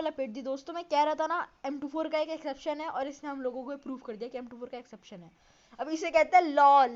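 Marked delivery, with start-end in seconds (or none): 5.8-6.9 a loud jeer with a strange edge; loud mocking call. Real, not acted. Gamer jeering at the enemy's blunder for viewers, loud mocking shout.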